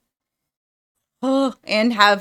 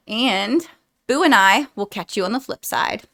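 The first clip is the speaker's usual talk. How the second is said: ordinary talk, nothing marked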